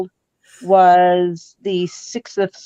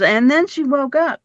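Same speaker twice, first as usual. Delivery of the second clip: imitated storyteller lilt, pitched higher; imitating Hilda; 6 semitones higher than usual. Repeating Hilda's catchphrase, imitated in a higher lilt. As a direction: lift the line high, quoting a friend. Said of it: "Hilda goes".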